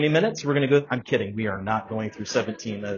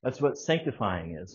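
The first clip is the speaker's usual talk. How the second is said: ordinary talk, nothing marked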